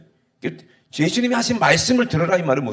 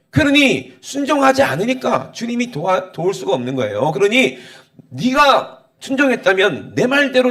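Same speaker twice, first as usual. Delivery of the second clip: raised, emphatic sermon delivery; forceful preaching rebuke; 6 semitones higher, 5 dB louder than usual. Real, not acted. Preacher voicing the Lord's rejection of the disobedient, stern rebuke. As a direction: pound out each accusation at a higher pitch.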